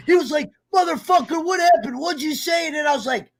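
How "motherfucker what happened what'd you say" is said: imitating a friend's outrage, pitched higher; mock outraged friend. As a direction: act out a mock outburst, higher. Retold text from angry friend.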